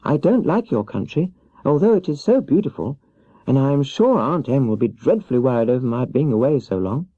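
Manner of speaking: put-on little girl pleading for help; young Dorothy. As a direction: play Dorothy small and meek, pitched high.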